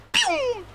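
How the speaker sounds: high-pitched "pew" zip; whoosh sound effect. Retold or imitated. Fighter speeding to his wife, high zooming noise. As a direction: zip past like a cartoon.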